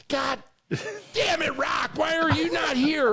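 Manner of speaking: high, shouted coach yell. Retold or imitated. Coach Ray yelling at Rock again, high shout. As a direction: yell at the player, fed up. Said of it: "he's like".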